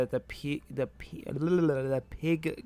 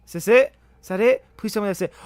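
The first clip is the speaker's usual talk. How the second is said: high, loud, pleading outburst; desperate hope; 7 semitones higher, 14 dB louder, faster than usual. Real, not acted. Player begging for the final hit, loud desperate hope. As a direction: plead loudly for it to be over.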